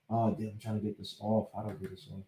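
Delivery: low, quiet grumble off to the side; half-voiced, distracted aside; muttering to himself. Real, not acted. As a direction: mumble it to yourself while fiddling with a computer. Talking to himself, computer trouble.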